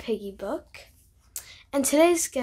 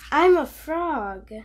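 slow, louder proud claim; Piggy proudly declares; 4 semitones higher, 7 dB louder, slower than usual. Acted. Child reading to viewers as Piggy, slow and proud. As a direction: announce it slowly like a proud new frog. Piggy announcing she's a frog.

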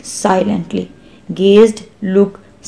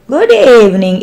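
ordinary delivery, nothing marked

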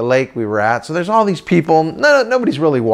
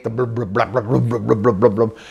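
chug like an idling boat; steady low blub-blub; boat motor noise; 4 semitones lower than usual. Retold or imitated. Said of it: "that cool sound boats make".

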